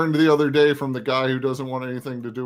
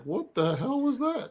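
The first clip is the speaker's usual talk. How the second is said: much higher, slow, stunned, in a put-on voice; mock shock; 12 semitones higher, 4 dB quieter, slower than usual. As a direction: act stunned at the blast. Reacting to explosion video.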